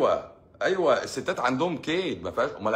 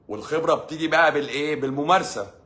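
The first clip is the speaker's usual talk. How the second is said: ordinary talk, nothing marked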